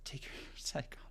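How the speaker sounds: laughing under her breath; whisper. Real, not acted. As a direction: whisper it with a giggle.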